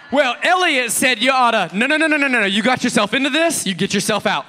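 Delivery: high, loud, heated comeback; mock quarrel. Spouse quoting the pastor during a fight, loud and indignant. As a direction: argue back loudly, cutting off with rapid no's.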